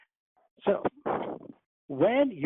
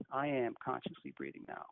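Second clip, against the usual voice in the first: much softer, barely audible "I am consciously breathing now."; inner voice, hushed; 2 semitones lower, 10 dB quieter than usual. Shown as a demonstration. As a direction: say it as if only in your mind. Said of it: "Say it in your mind".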